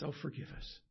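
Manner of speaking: a quiet, stricken plea for pardon; apologetic father. Retold or imitated.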